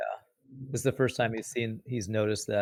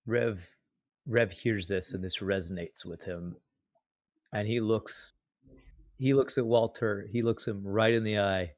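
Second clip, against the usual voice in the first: normal talking voice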